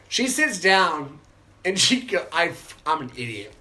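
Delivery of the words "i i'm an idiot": ordinary delivery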